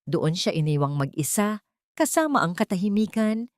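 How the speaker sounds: usual speaking voice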